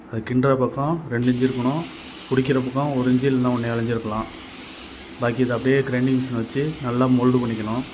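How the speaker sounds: ordinary delivery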